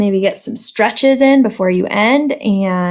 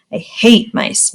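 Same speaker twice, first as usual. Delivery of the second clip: ordinary delivery, nothing marked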